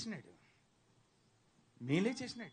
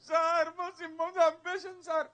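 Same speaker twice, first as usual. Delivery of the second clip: hammed-up crocodile tears, higher; mock fake sob; 7 semitones higher than usual. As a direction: whine like a fake mourner. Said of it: "దొంగ ఏడుపు ఏడిచేటోడా". (నటించిన దొంగ ఏడుపు)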